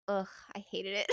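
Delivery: mock-sulk the complaint; imitating a disgusted friend. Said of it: "Carlos was like".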